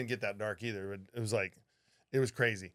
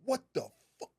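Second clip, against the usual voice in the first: shocked outburst; 13 semitones higher than usual. Retold or imitated. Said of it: "I was like".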